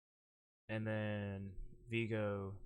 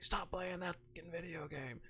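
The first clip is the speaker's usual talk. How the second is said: pitched-up, held-back mock shout; retold yell; 8 semitones higher than usual. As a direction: imitate a stressed-out man snapping. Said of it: "yells at the guy".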